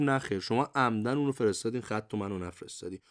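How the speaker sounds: usual speaking voice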